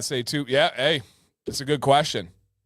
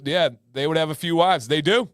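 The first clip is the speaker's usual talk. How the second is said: ordinary talk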